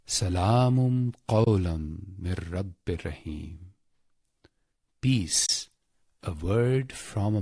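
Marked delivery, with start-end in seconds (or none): none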